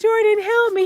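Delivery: high, loud call for help; mock-frantic yell; panicked plea. Mother running to son for help, panicked.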